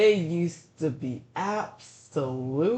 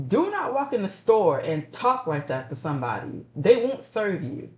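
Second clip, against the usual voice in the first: ordinary talk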